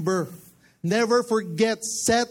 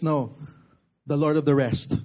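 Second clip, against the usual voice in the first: ordinary talk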